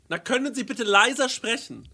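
high, soft, singsong mimicry of a stranger; scolding woman. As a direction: repeat the complaint as the woman, high and softly. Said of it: "Und dann sagt sie so". (tadelnde Frau, hoch und leise nachgeahmt)

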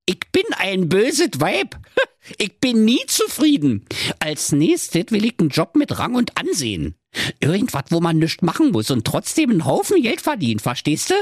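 high-voiced gloating nag; wicked fairy-tale wife, brash and high; demanding wife.

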